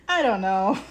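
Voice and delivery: laughing through the words. Real, not acted. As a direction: let the laugh break in. Repeats it with a laugh.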